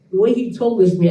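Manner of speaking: ordinary talk, nothing marked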